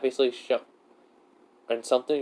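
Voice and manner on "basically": usual speaking voice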